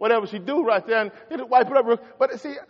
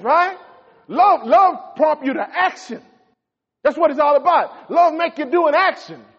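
loud, high, driving sermon voice; preaching at full force; 7 semitones higher, 6 dB louder than usual. Acted. Preacher driving home love to his congregation, loud and fervent.

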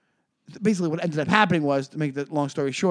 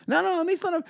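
eager to please; 13 semitones higher, faster than usual. Retold or imitated. Acting eager people pleaser.

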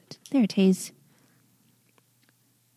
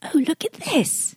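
excited whisper; 7 semitones higher, 7 dB quieter than usual. Real, not acted.

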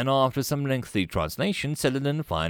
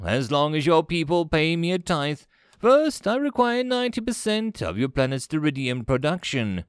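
imperious, raised-pitch demands; conquering commander; 6 semitones higher than usual. Acted. Commander demands tribute from beaten president, imperious. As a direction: lay down terms as an overlord.

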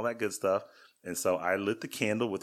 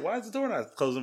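puzzled; 12 semitones higher than usual. Retold or imitated.

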